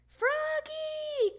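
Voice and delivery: high, loud, drawn-out call; a mom calling her child from afar. A narrator's reading. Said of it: "called his mom".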